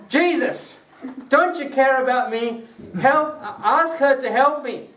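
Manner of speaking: high, laughing mock complaint to Jesus; bold Martha complaining. Martha demanding that Jesus make her sister help, high and laughing. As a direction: play Martha whining boldly, laughing through it. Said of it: "Martha was bold".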